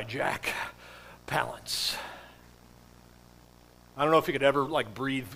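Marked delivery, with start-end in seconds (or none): 0.0-2.0 breathless, halting cowboy actor's voice; Jack Palance. Acted. Preacher mimicking the actor who played Curly, breathless. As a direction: breathe the name out softly, struggling for air. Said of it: "that's how he talked all the time".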